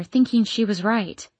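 usual speaking voice

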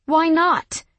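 loud, high sharp demand. Acted. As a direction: demand it outright. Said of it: "I finally demanded".

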